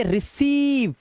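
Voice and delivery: रिसीव with a held ee; long vowel. Shown as a demonstration. Teacher showing EI as ई, drawn-out ee in रिसीव. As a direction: stretch the second vowel of रिसीव. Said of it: "long vowel sound".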